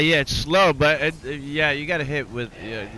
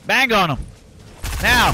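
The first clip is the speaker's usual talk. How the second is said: high, loud urgent command; combat callout; 8 semitones higher, 5 dB louder than usual. Real, not acted. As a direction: yell the go signal. Ordering teammate to fire.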